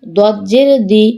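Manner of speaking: no stretch on দি; short vowel. Shown as a demonstration. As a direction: end on a short দি. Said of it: "কোথায় দ্রুত পড়ি".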